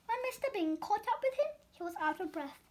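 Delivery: ordinary delivery, nothing marked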